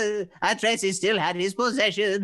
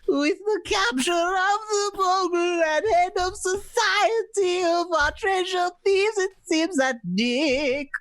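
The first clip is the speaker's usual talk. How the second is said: put-on high, bright old-time announcer wrap-up; radio announcer; 7 semitones higher than usual. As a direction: close the episode like a 1940s radio host. Reads radio show announcer outro.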